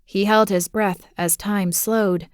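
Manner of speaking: ordinary delivery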